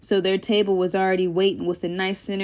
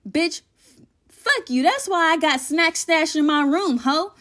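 high sassy comeback; 7 semitones higher than usual. Acted. Palace retorts to Tay.

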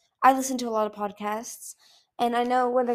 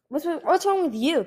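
higher, louder blurted alarm; 8 semitones higher, 4 dB louder than usual. A narrator's reading. Kinkajou alarmed at the battered Icicle, blurting. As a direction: blurt it out in shock. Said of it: "Kinkajou blurted".

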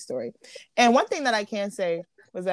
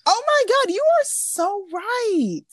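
surprised; 12 semitones higher, 5 dB louder, much slower than usual. Real, not acted. Sudden realization of anniversary.

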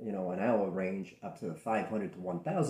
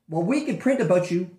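mock reporter threat; 9 semitones higher, 11 dB louder than usual. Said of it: "the reporter's threat".

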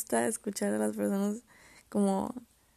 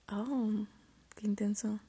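ordinary talk, nothing marked

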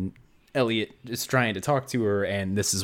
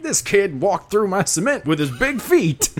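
high, louder dopey workman voice; dumb cement guy; 10 semitones higher, 4 dB louder than usual. Retold or imitated. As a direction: play a goofy, whiny construction worker. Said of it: "the dumbass cement guy is like".

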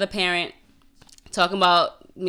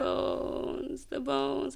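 soft, higher echo of "the bones"; hushed lament; 7 semitones higher, 9 dB quieter, much slower than usual. Retold or imitated. Echoing friend about being skinny, hushed mock lament. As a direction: half-whisper it, higher than usual.

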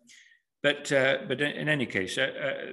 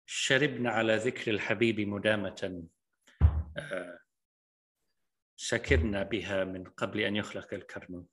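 poem recitation; 3 semitones lower, faster than usual. Shown as a demonstration. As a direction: recite the couplet rapidly under breath. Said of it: "I feel a Persian poem coming on".

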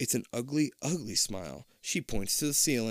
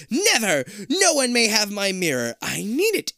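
high, loud furious shriek; furious monster; 10 semitones higher, 7 dB louder than usual. Acted. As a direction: screech it in a rage. Queen furious.

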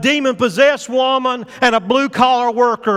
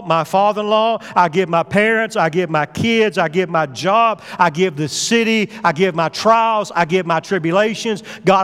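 lower, hushed prayer of surrender; earnest prayer; 3 semitones lower than usual. Acted.

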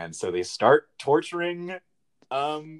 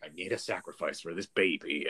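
much lower and softer, ominous; deep TV demon voice; 11 semitones lower, 6 dB quieter than usual. Retold or imitated. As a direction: intone it like a sinister god. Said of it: "the T V is like".

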